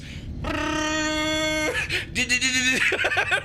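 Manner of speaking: high-pitched giddy giggle. Real, not acted. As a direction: burst into a squeaky giggle. Gamer laughing at flying car.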